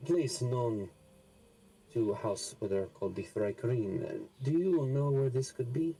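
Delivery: ordinary talk, nothing marked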